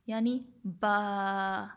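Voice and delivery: slow, stretched با held two beats; elongated letter ba. Shown as a demonstration. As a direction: draw out با for two counts. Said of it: "با کو ہم لوگوں نے کرنا ہے دو الف لمبا".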